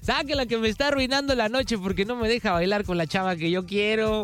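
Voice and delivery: hammed-up plea to the bouncers; mock indignant complaint. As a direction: play along, demanding she be thrown out. Plays along with joke's shouted punchline.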